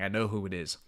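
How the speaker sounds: ordinary talk, nothing marked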